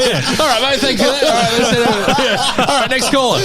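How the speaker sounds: high, bright radio host. Pretend. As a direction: hype up the next caller. Mock radio host, laughing.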